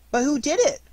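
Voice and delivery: put-on high, loud child's question; squeaky, curious youngster; inquisitive Roo. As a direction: pipe up eagerly. Roo asks, voiced high.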